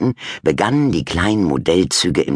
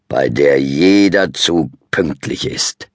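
louder, slow proud dad voice; 5 dB louder, slower than usual. A narrator's reading. Dad proudly adding about his model railway, slow and louder. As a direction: savor every word proudly. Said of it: "ergänzte Papa stolz".

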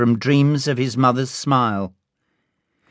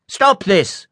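ordre assuré, un peu plus haut; confident command; 7 semitones higher than usual. A narrator's reading. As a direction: coupe court avec autorité. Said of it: "commanded a confident voice".

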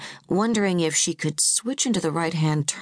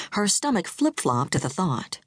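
plain reading voice

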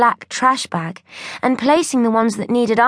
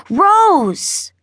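excited call; 9 semitones higher, 3 dB louder than usual. A narrator's reading. Excitedly calling his sister.

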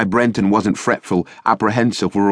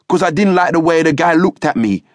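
put-on high, louder rude-boy retort; truculent; 9 semitones higher, 4 dB louder than usual. A narrator's reading. Cocky youth answering the sergeant, truculent and loud. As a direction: throw it back at him with attitude. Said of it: "he said truculently".

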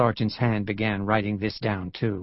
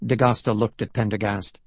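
usual speaking voice

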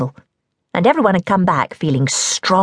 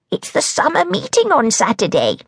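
much higher hag voice; hag coaxing; 9 semitones higher than usual. A narrator's reading. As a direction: coax the toad out. The hag coaxes the toad.

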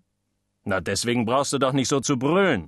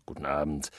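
a soft, distant "guten Abend"; faint echo; 11 semitones lower, 9 dB quieter than usual. Acted. Guests greeting in the background, "guten Abend" said softly. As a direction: murmur "guten Abend" like a far-off voice. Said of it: "Großes Guten Abend".

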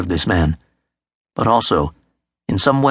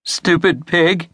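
put-on slow, higher-pitched upset child; shakily; 6 semitones higher, slower than usual. A narrator's reading. Martin insults the brother who hurt him, a little shakily. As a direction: drag the insult out, mildly upset. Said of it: "said Martin shakily".

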